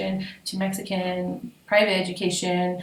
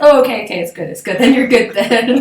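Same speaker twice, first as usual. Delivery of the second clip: mock reassurance; 3 semitones higher, 10 dB louder than usual. Imitating Londoners reassuring.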